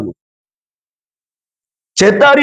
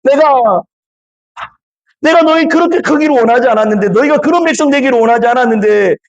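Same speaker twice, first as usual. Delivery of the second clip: higher, quick indignant rebuke; angry rebuke; 5 semitones higher than usual. Retold or imitated. God angrily answering the grumbling Israelites, indignant and quick. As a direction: voice God fed up with grumbling people. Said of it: "막 이렇게 화내는 장면이에요".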